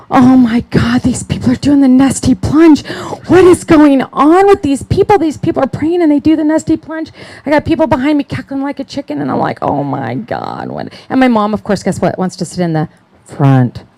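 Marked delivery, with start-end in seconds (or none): 0.1-7.1 high, loud retold disbelief; mock appalled. Newcomer reacting to strange church prayer, mock appalled. Retelling her shock at prayer line. 9.6-10.9 low, drawn-out retold "oh my God"; mock dismay. Newcomer dismayed by cackling behind her, mock dismay.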